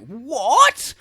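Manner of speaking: high, loud baffled what; mock surprise. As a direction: play the stunned villain. Villain surprised.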